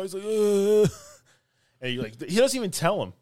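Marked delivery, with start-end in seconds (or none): 0.2-0.9 louder, higher drawn-out groan; imitated zombie groan. Imitating the zombie housemate answering, drawn-out groan. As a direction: groan back like the undead.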